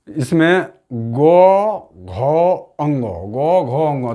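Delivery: गो, घो, अंगो drawn out slowly with throat force; teacher repeats गो, घो, अंगो slowly and heavily; slow forceful demo. Shown as a demonstration. Teacher to students showing effort in गो घो: slow, forceful. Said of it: "ज़्यादा बल लगता है".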